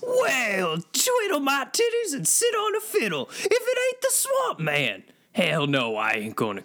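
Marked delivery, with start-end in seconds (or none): 0.0-5.0 hillbilly Sasquatch. Acted. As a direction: put on a folksy country Sasquatch. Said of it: "that voice is a little insensitive".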